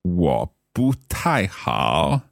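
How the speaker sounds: slow repeat of 我不太好; careful learner repeat. Shown as a demonstration. Learner echoing 我不太好 after the teacher, slow and careful. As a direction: echo 我不太好 slowly.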